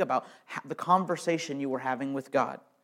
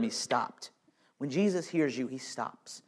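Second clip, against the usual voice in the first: usual speaking voice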